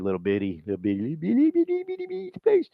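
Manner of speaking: climbing into a very high squeak; mock baby talk. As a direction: belittle them in silly, rising baby talk. Mock recruiter belittling a small broker.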